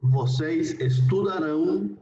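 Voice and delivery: vocês estudarão slow, with a rising end; teacher dictating slowly; slow model pronunciation. Shown as a demonstration. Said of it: "la conjugación en futuro sería".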